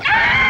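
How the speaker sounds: a sudden very high shriek; comic high yelp. Acted. Truck crash, high shriek. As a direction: let out a cartoon scream.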